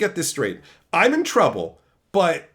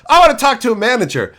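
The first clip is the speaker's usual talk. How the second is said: angry customer; 5 semitones higher, 10 dB louder, faster than usual. Retold or imitated. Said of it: "She'd be like".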